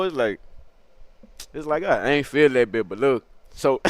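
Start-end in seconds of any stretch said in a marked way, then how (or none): none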